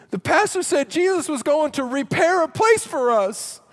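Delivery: put-on high, loud child's wail, slowing at the end; upset kid. Child crying to mommy, high and loud. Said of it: "Kid starts getting emotional and crying".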